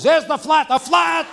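high, loud gleeful yelling; excited kids. Acted. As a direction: shout it like kids spotting a treat. Gentile kids excited at flat tire.

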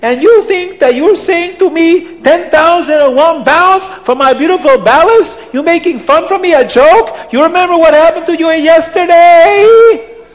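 put-on high, loud accented tirade; outraged, incredulous rant with swooping questions; angry Abdallah. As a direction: scold him in mounting indignation. Abdallah scolds the poor man angrily.

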